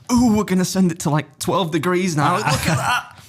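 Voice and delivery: mock excitement over a tilting Land Rover; mock excited. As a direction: gush like a car ad. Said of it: "so you get excited about the angle".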